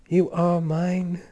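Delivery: ordinary delivery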